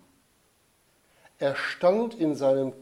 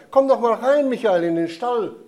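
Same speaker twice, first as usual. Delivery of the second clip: high, loud inviting call; 7 semitones higher, 8 dB louder than usual. A narrator's reading. Josef waving Michael into the stable, high loud call. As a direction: call the boy warmly into the stable from a distance.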